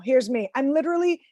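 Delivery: ordinary talk, nothing marked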